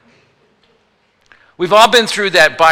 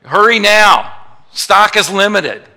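pushy sales pitch; 4 semitones higher, 6 dB louder, slower than usual. Retold or imitated. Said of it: "Have you seen those?".

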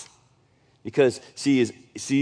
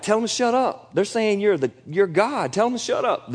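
high, mock indignant fussing; fussing Pharisees; 7 semitones higher than usual. Pharisees fussing at Jesus over the singing, high and huffy. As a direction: scold like an offended Pharisee. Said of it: "they fuss at him like".

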